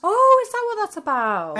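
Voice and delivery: high, loud surprised question. Real, not acted. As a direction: blurt it out in surprise. Surprised by a song reference.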